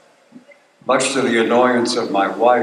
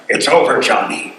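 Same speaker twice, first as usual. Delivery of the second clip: Colonel Troutman; 3 semitones higher than usual. Acted. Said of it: "He was Colonel Troutman".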